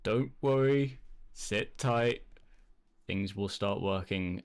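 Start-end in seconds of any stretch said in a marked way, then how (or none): none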